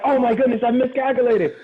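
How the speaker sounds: high, loud cry of dismay; hammed-up panic at a blunder; mock alarm. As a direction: act out a sudden horrified realization. Retold hero's sudden alarm, amused teller.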